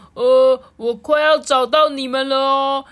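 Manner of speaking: high, loud seeker's call; doctor character. A narrator's reading. Blindfolded doctor to hiding friends, loud eager call. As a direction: announce it eagerly to hidden friends. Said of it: "就说".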